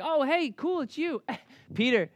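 casual mock Thomas. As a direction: toss it off like an unbothered friend. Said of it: "He wasn't like".

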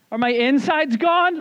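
high, loud, slow panicked cry. Retold or imitated. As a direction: freak out in horror at yourself. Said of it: "you're like".

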